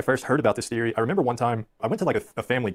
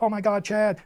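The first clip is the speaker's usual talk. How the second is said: high, gushing squeal of delight; girl greeting Chad at the door, gushing excitedly; 12 semitones higher, slower than usual. Retold or imitated. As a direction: squeal it like a thrilled girl greeting her crush. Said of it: "She's just like".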